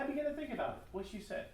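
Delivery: ordinary delivery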